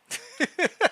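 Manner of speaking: quick high laugh; high giggle. Real, not acted. Player laughing off the typo, giggling. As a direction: giggle it away.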